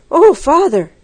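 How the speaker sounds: high, loud cry of alarm; exclaiming. A narrator's reading. Breathless girl bursts out to her father about something terrible. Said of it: "Anna exclaimed".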